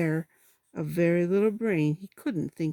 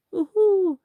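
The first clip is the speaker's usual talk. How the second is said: high, loud gloating hoot; gloating heffalump; 12 semitones higher, 9 dB louder than usual. Acted. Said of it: "gloating sort of way".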